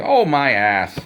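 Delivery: a hammed-up grumble, drawn out; mild exclaim. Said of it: "I go".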